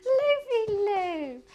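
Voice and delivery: cooing at pet. Real, not acted.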